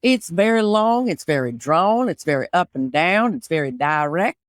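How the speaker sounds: put-on long, drawn-out Texan accent; Southern drawl. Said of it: "Texas is more long and drawn".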